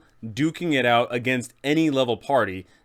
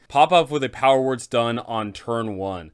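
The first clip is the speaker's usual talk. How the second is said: normal talking voice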